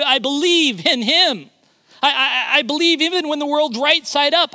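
building fervent declaration; voicing the believer's creed to the congregation; preaching creed. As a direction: preach each I believe with rising force.

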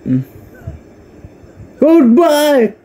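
normal talking voice

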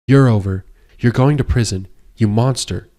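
loud, defiant shout; yelling. Acted. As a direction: summon every ounce of courage and yell. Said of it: "She yelled at him".

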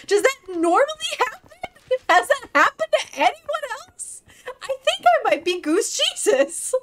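very high, astonished squeal; giddy disbelief. Real, not acted. Streamer wondering if the fence glitch makes her Goose Jesus, giddy disbelief. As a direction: let the voice soar in amazement. Amazed at phasing through fence.

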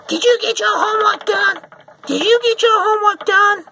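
put-on furious mother screeching at her kid; harsh mom yell. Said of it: "Some moms might do this harshly".

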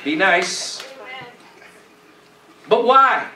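normal talking voice

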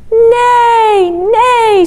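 high, loud imitated whinny; horse neigh. A narrator's reading. Reader voicing the horse calling to the spider, high neighing. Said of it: "said the horse".

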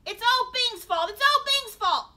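high, shouted blame; frantic babbling. A narrator's reading. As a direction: babble it out at full pitch, desperately. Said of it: "Darren babbled".

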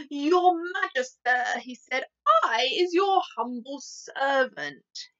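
a big giant bowing humbly to the Queen; gentle giant. Acted. The BFG's voice in story reading.

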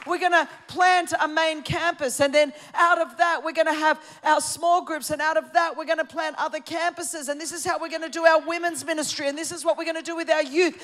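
loud, fired-up preacher announcing the plan; pastor laying out the plan. Retold or imitated. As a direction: proclaim it like a pastor rallying the church. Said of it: "And so he says".

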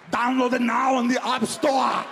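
put-on slow, loud Austrian accent shout; Schwarzenegger ad voice. Arnold in the Mobile Strike ad, loud slow Austrian accent. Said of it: "that commercial for that app".

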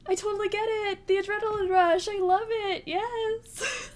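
high, loud adrenaline rush; rattled excitement. Real, not acted. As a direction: shout it out high, buzzing from the scare. Rattled right after a scare.

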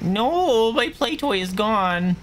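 high, slow whiny little voice; cute voice. Pretend. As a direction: squeak it like a sad kid. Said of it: "I should have gave him a cute voice".

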